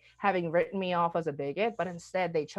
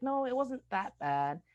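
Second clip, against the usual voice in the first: higher, slow, hesitant reassuring voice; reluctant reassurance; 7 semitones higher than usual. Retold or imitated. The person who called her out, reassuring her, higher and slow. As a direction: soothe them though you'd rather not.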